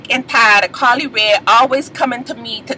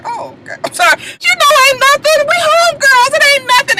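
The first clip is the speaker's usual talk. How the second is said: mock backing-down, tiny at first then very high; Carly Red. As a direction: apologise in a squeaky, placating imitation. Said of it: "Carly Red was like".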